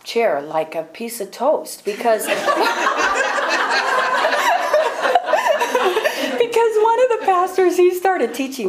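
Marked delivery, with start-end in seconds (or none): 2.4-6.8 helpless high laugh; long high burst of laughter. Real, not acted. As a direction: laugh at her own boldness. Recalling popping out of chair.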